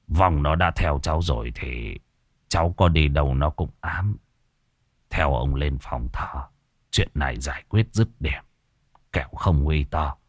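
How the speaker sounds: a grave grandfather shaking his head; sighing old man. A narrator's reading. Old man warning the tenant of the haunting, low and weary. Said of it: "lắc đầu thở dài".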